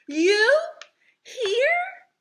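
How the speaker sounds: very high, loud astonished gasp; gasping in surprise. Acted. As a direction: gasp it out, shocked. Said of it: "gasped Mister Bird".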